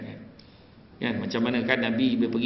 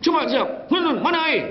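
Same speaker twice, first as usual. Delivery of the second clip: much higher, louder mock demanding; harsh husband ordering his wife, high and loud; fierce husband; 5 dB louder, slower than usual. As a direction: bark for water like a harsh husband.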